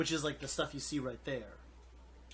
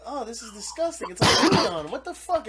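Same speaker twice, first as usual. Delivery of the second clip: disgusted; 7 semitones higher, 9 dB louder than usual. Retold or imitated. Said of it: "you're like".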